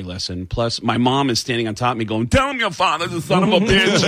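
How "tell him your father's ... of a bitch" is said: mom's angry order. Retold or imitated. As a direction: play the bossy mother barking instructions. Mom yelling in retold scene.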